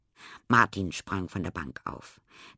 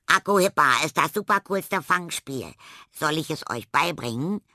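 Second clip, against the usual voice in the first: calling out; 6 semitones higher, 4 dB louder than usual. A narrator's reading. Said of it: "rief Tilda".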